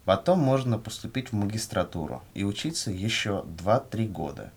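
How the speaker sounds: usual speaking voice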